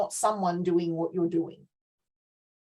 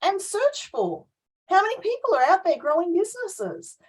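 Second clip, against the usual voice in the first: rant mode; 7 semitones higher than usual. Real, not acted. Coach ranting to co-host about generalizations, higher worked-up rant. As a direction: push the point like a fired-up coach. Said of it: "I know I'm now in full rant mode".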